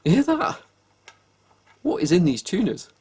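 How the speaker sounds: can you believe this noise; amazed disbelief. Real, not acted. Showing viewers the gritty tuners, amazed and incredulous. Discovers crunchy tuners on camera.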